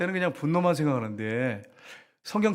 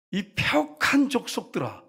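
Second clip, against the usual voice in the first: slow, higher rebuke; mock scolding; 6 semitones higher, slower than usual. Moses rebuking Israelites, slow mock scolding. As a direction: drag out the insult. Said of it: "뭐라 그럽니까".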